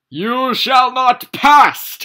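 huge slow wizard bellow; drawn-out booming scream. Acted. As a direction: roar it like a wizard blocking the way. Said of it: "he screamed".

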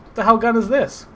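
normal talking voice

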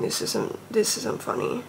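barely voiced whisper. Real, not acted. Fan telling viewers it's no joke, whispering. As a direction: drop to a whisper, overwhelmed. Overwhelmed, voice dropping away.

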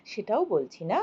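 usual speaking voice

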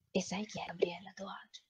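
hushed conspiratorial whisper. Real, not acted. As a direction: whisper it like a secret. Secret revealed to co-host. (sussurrato in confidenza)

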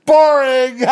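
high, loud, cackling yell; laughing taunt. Retold or imitated. Taunting the staff to scatter them. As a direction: whoop it out through laughter.

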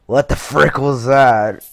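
loud yell of disbelief; startled outburst. Real, not acted. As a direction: burst out loudly, baffled. Startled by the game.

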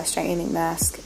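ordinary delivery

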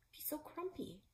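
ordinary delivery, nothing marked

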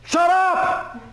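loud, very high-pitched yell; retold full shout. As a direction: explode to silence the interrupter. Retold final outburst at interrupter.

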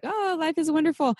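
high, mock-breezy cheer; mock cheerful. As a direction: toss it off like nothing's wrong. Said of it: "So it might sound a little irreverent".

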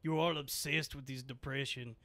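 put-on Russian accent lecture. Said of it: "a Sopranos monologue".

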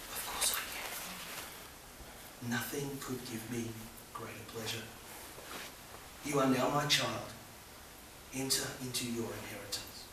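soft, partly whispered, tender reply; loving Saviour. Acted. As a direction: answer gently, a loving Saviour welcoming a child. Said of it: "looks lovingly at you and says".

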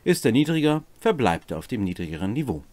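usual speaking voice

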